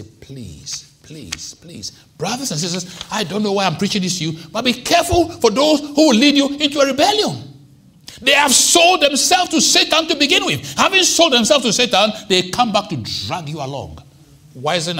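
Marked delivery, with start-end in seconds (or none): 0.0-1.9 much softer, lightly voiced begging; mild pleading Moses. Moses begging God to spare the people, softly pleading. 4.5-14.0 much higher, much louder preaching cry; full-force preaching. Acted. Preacher telling the congregation of the rebellion, at full force.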